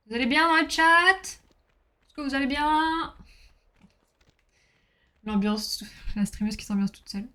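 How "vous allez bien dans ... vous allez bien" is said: high bright call to the chat; drawn-out sing-song hello to chat; cheerful greeting. Real, not acted. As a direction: call out happily to the viewers.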